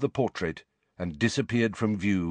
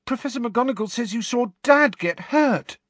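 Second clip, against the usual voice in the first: frightened; 4 dB louder than usual. A narrator's reading. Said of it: "who looked frightened".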